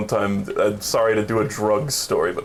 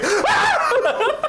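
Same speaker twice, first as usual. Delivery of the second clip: high startled shriek into laughter; scared horror game streamer. Retold or imitated. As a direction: scream then crack up laughing. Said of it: "he, like, jump scared me".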